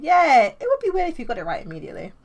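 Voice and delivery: high, loud upbeat reply; bubbly and light; cheerful. Acted. Happy Amy reassures.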